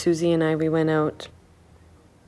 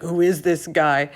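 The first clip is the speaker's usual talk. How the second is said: Canadian shocked. Retold or imitated. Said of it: "Canadian shocked".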